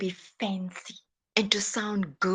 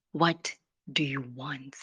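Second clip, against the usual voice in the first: usual speaking voice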